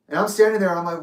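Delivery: usual speaking voice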